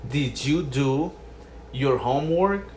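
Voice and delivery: slow, word by word, with pauses between chunks; spelling it out slowly for learners; slow dictation. Shown as a demonstration. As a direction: dictate it piece by piece for students to write. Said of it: "Con signo de interrogación".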